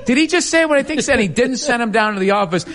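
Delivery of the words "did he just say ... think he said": mock disbelief. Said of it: "he played it off like".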